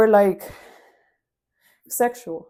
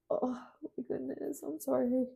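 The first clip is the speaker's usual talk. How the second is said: quiet tearful apology after crying; soft, teary, catching her breath; crying, worn out; 3 semitones higher, 8 dB quieter than usual. Real, not acted. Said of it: "it's not easy to watch somebody cry".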